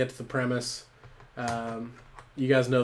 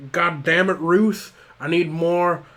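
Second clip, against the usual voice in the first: loud, raised-pitch shout; acted yelling firefighter; 5 semitones higher, 8 dB louder, slower than usual. Benny calls to Ruth for water pressure, acted yell. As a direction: holler in exasperation at a coworker. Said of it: "he's just screaming".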